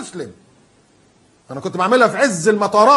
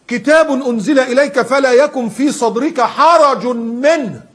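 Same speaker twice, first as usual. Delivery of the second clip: slow, high, loud chanted verse; a preacher reciting scripture; Quran recitation; 7 semitones higher, 6 dB louder, much slower than usual. Acted. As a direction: intone the verse slowly like tajweed.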